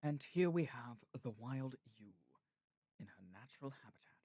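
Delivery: measured nature-documentary narrator impression; David Attenborough. Narrating a wild ewe in her habitat, Attenborough impression. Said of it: "like, David Attenborough".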